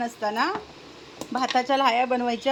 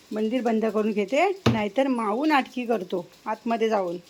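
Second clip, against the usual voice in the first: normal talking voice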